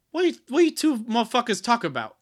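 high-pitched, hammed-up challenge; mock indignant kid. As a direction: butt in as an incredulous third kid. Third kid butts in, acted.

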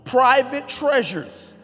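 loud, high preaching read; emphatic scripture reading. Acted. Pastor reading David's gifts to the church, booming. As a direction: declaim each treasure with weight.

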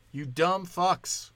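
ordinary delivery

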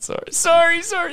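high-pitched mock Canadian apology; Canadian accent. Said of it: "I just turned Canadian".

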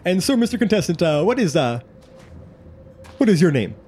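showy announcer, hesitating as he asks; booming game show host. Acted. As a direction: quiz the contestant like an emcee. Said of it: "you hear the voice go".

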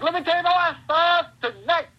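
wild, high-pitched wrestling promo; Dusty Rhodes. Acted. Breaking into an old promo in front of friends, wild and high. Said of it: "break into the Dusty Rhodes, like, wild".

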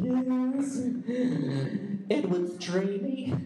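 a light imitation of swooning girls. Girls gushing over a Twilight heartthrob, mock swoon. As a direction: mimic girls swooning, drawn out. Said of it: "you hear all the girls like".

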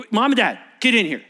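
loud call; summoning parents. Acted. Pharisees summoning the parents, loud. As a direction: bark them in.

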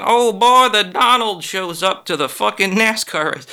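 high, loud hillbilly gush. Retold or imitated. Mocking redneck announcer.

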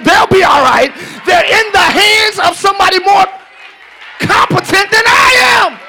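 loud, rising preacher's cry; a whooping preacher driving the point home; full-force preaching. Acted.

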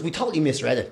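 ordinary talk, nothing marked

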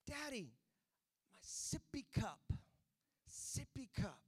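put-on slow, unclear little-kid plea; a hint of a toddler mumbling. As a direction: lightly voice a two-year-old asking for his cup. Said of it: "didn't really, you know, speak very clear".

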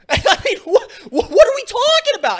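high, loud incredulous put-on voice; high-pitched mocking outrage at a bad take; Elliott impression. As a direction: squeak out the disbelief like a mock Elliott. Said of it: "I'll do my best Elliott impersonation".

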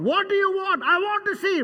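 high, loud exchange. Acted. Jesus and the blind man calling to each other, high and loud. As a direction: call it out across the road.

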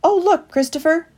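excited exclamation. A narrator's reading. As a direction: call out in delight. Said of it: "exclaimed Woodley".

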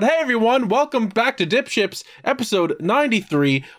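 excited host intro. Acted. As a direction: greet the audience like a host. Opening the podcast episode.

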